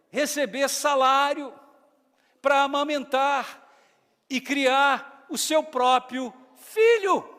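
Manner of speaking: loud, high, emphatic proclamation; climactic preaching. Acted. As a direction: drive the punchline home. (clímax da pregação em voz alta)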